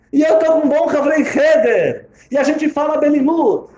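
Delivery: high, bright, glowing reading of good news; cheerful letter. Acted. Friend rereading the son's letter joyfully to the father. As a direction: read it like a proud, happy report.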